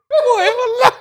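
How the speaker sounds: high laughing burst; laughing hard. Real, not acted. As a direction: laugh through the words. Laughing about the water trips.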